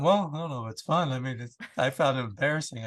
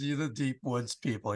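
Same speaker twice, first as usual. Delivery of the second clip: usual speaking voice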